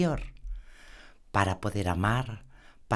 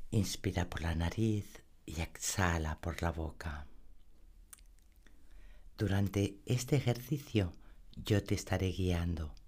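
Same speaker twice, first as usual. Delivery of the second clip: guided meditation; 3 dB quieter than usual. Acted. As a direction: lull the listener into calm. (voz suave de meditación guiada)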